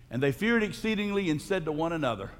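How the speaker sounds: ordinary talk, nothing marked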